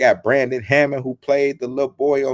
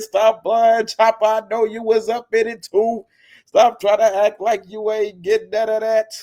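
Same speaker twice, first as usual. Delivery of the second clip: playful call-out; 10 semitones higher, 5 dB louder than usual. Real, not acted. Teasing friend, calling him out.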